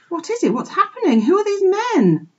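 very high, urgent piglet voice; alarmed questioning. A narrator's reading. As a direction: fire off anxious questions. Bewildered piglet questions old sheep.

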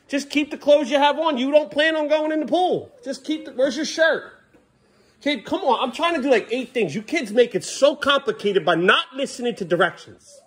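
high, strained nagging at the end of her rope; exasperated scolding. Real, not acted. Parent pleading with son to keep shirt on, exasperated. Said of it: "I'm getting frustrated with you".

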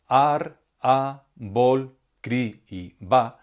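"ar", "a", "bol", "cri", "ba" each said slowly and set apart; stressed syllables alone. Shown as a demonstration. Teacher showing the class which syllables carry the stress, slowly. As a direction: pick out each stressed syllable, slow and spaced. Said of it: "son las sílabas que pronunciamos con más fuerza".

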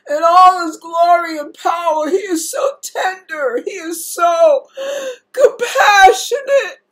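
real tears, praising through them; weeping with gratitude, voice cracking high; crying. Real, not acted. Weeping while praising God's tenderness.

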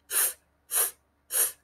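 f, f as a long voiceless blown f like blowing out a candle. Shown as a demonstration. Teacher showing children the candle sound, breathy blown f. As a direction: blow out f, f softly like a candle. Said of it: "So can you hear the sound?".

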